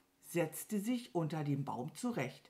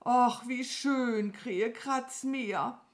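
slow, higher, drawn-out sigh of pleasure; blissful relief; 7 semitones higher, 3 dB louder, slower than usual. Acted. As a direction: savour the scratching, dragging the words. Itchy cow enjoys being scratched. (wohlig gedehnt und genüsslich)

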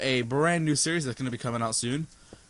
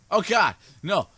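usual speaking voice

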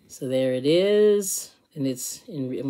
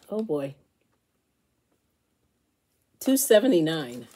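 ordinary talk

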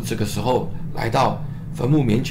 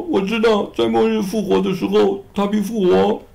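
higher pleading voice for Martha; higher-voiced sister, gently reproachful; grieving Martha; 7 semitones higher than usual.

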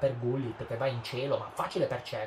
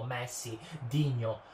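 ordinary delivery

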